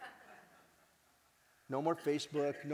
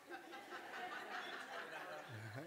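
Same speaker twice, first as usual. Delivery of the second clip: quiet laugh; 2 semitones higher, 10 dB quieter than usual. Real, not acted. Laughs at inside joke.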